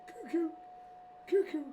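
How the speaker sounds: high, sing-song cuckoo call; eerie high cuckoo, twice, falling; creepy movie killer. Pretend. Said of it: "that's my long legs thing".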